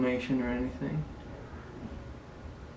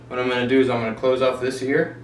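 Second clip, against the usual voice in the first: normal talking voice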